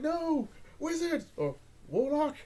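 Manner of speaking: high alarmed shout; shouted warning. Retold or imitated. Prince calling out to the archmage, alarmed shout. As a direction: yell out to stop him.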